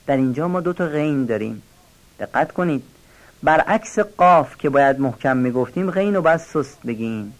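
usual speaking voice

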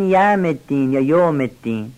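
یوم said wrongly as "yam", then "yom"; یوم الدین with no w glide, "yam" or "yom"; dropped waw. Shown as a demonstration. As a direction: leave the w out of both takes of یوم. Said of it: "بعضی هم واو رو نمیخونن".

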